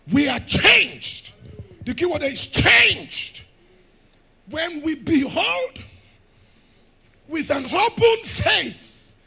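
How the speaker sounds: loud, high, drawn-out preaching cry; full-force preaching. Real, not acted. Preacher pressing the word on his listeners, at full force. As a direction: drive the word into their spirit.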